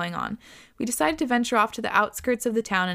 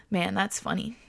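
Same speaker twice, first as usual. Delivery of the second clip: ordinary talk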